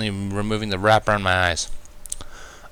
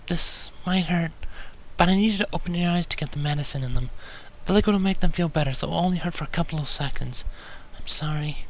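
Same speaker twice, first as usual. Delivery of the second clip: timid request; 8 semitones higher than usual. A narrator's reading. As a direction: warn him tenderly and timidly. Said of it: "Fluttershy requested timidly".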